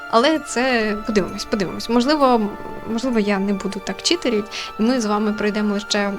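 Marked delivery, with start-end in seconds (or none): none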